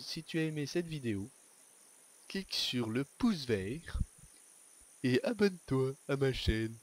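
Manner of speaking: high, slow hammed-up YouTuber plug; mock YouTuber outro. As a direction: overdo the cheesy channel plug. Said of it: "Non, je déconne".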